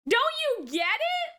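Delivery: ordinary delivery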